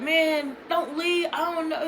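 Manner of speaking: high mock pleading; imitating pleading boyfriend. Imitates boyfriend begging her to stay.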